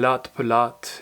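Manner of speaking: self-consciously French pronunciation; awful French accent. Real, not acted. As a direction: try a French accent and miss. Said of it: "excuse my awful French accent".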